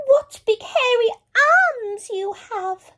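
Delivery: put-on girl eyeing the hairy arms, high; careful wonder. A narrator's reading. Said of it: "Little Red said carefully".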